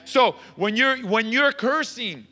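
high, energetic exhortation; rousing preaching. Real, not acted. Pastor urging church toward a new way of talking, rousing preaching. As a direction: urge the church on with rising force.

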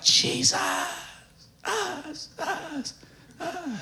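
mimicked echo, high and fading softly; imagined echoing cry. Preacher acting out how he imagines the lepers' cry, echoing cry. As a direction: repeat the last syllable like an echo dying away. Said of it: "That's how I read it in my mind".

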